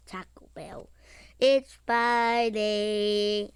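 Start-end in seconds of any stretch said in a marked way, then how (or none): 1.4-3.5 drawn out. Acted. Drawn-out playful line.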